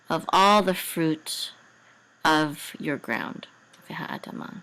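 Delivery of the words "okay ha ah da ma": whisper. Real, not acted. Sounding out syllables under breath.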